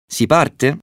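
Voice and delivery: high, rising query; eager question. A narrator's reading. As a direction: ask it pitched well up. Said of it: "chiese Settimo". (domanda acuta e impaziente)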